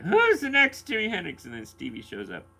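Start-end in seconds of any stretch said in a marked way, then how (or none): 0.0-1.5 a mock Irish brogue, higher and louder; Irish accent. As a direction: slip into an Irish lilt mocking the crowd. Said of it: "I just segged into an Irish accent there".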